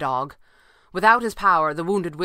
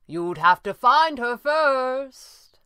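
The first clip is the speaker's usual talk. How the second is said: high, slow, loud taunt; sing-out mocking reply; 9 semitones higher, 5 dB louder, much slower than usual. A narrator's reading. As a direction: taunt him from above, drawing it out. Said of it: "came the taunting reply".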